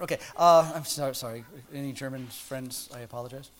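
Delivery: normal talking voice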